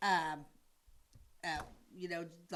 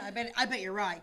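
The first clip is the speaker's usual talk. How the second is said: ordinary talk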